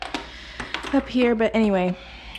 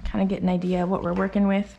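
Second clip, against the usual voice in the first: ordinary talk, nothing marked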